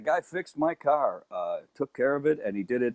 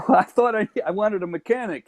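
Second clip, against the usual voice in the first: incredulous; 9 semitones higher than usual. Real, not acted. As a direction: squeak it out in disbelief. Caught off guard, laughing.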